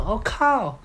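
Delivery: high startled exclamation of recognition; shocked shout. Retold or imitated. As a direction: blurt it out in sudden surprise. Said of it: "她就很大声的叫我名字".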